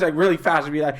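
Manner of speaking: ordinary talk, nothing marked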